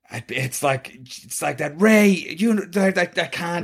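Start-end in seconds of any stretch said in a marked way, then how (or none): 1.8-3.6 high mock Irish voice; a playful Irish lilt; Irish accent. Mocking actress's Irish accent for friends. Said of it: "saying Ray with an Irish accent".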